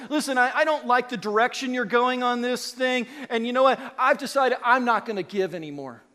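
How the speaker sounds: loud, huffy refusal to give; a member threatening the pastor, loudly indignant; mock annoyed giver. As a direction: play the miffed member holding back money. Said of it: "Or they'll say".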